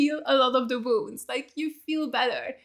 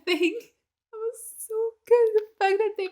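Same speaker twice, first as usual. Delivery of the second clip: laughing; 9 semitones higher than usual. Real, not acted. Laughing at funny scene.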